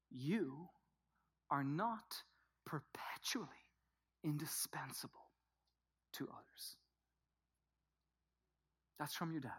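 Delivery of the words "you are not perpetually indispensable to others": soft, low, half-whispered message; halting near-whisper, phrase by phrase; Father's hushed invitation. Acted. Preacher voices God's invitation to listeners, hushed Father's voice. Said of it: "hear this invitation from your Father".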